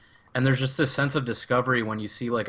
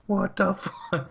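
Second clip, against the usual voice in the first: slow, high-pitched mock dismay; drawn-out, acted bewilderment; stunned disbelief; 8 semitones higher, much slower than usual. Player destroyed by the thing in the corner, stunned.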